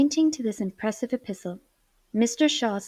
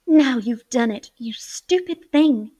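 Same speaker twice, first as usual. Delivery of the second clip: angry cry; 3 semitones higher, 8 dB louder than usual. A narrator's reading. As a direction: snap at her friend, angry and dismayed. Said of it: "cried Fanny, both angry and dismayed".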